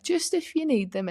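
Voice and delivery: high-pitched, tentative little offer; imitating tactful psychologist. Psychologist gently offering tissues, voiced in story.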